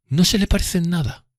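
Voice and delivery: high, affected contradiction; voicing Drumley's dismissive retort; curt retort. A narrator's reading. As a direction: cut him down dismissively. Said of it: "replicó".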